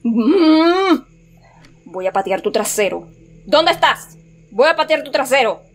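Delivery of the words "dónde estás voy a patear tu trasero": high-pitched challenge; raised voice, daring a hidden foe to show; shouted threat. Real, not acted. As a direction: call out the threat, worked up. Repeats threat, calling out.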